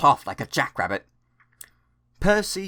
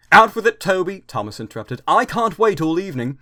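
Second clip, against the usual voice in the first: impatient; 7 semitones higher, 6 dB louder than usual. Acted. Impatient Thomas cuts Toby off.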